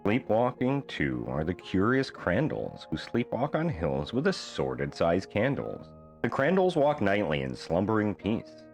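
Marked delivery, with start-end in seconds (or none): none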